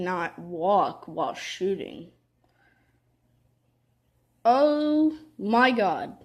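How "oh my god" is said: exasperated. Real, not acted. Dies in the game.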